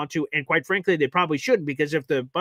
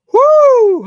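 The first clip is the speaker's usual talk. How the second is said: loud whoop; 14 dB louder than usual. Real, not acted. Touchdown stands, fan celebrates.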